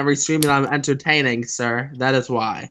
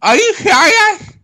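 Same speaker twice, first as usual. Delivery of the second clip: surprised; 5 dB louder, slower than usual. Real, not acted. Cut out, disbelief.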